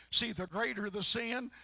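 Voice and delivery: ordinary talk, nothing marked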